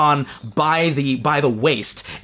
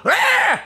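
startled cry. Acted. Cart driver falls, cries out.

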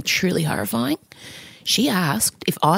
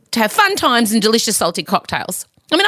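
ordinary talk, nothing marked

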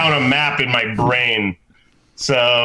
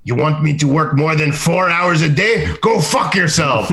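dismissive French attitude; 6 semitones higher, 3 dB louder than usual. Retold or imitated. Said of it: "the people's attitude about, like".

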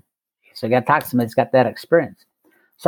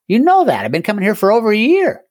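indignant retort; 8 semitones higher, 3 dB louder than usual. Retold or imitated. Defending himself to Conklin.

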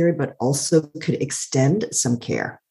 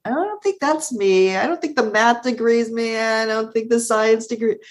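mock dismissive; 7 semitones higher than usual. Quoting younger self rejecting degrees.

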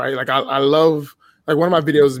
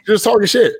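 raised, high and loud retort; retold shout; 7 semitones higher, 5 dB louder than usual. To a commenter talking shit, a raised louder retort. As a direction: snap back higher and louder.

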